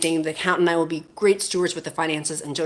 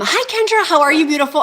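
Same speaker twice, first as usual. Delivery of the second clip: excited greeting; 10 semitones higher, 6 dB louder than usual. Real, not acted. Greeting a viewer in live chat.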